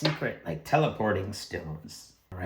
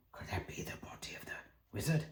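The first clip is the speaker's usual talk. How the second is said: quick, barely voiced whisper; hushed wondering; 13 dB quieter, much faster than usual. Thieves finding a body in the room, whispering. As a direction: whisper it as a furtive question.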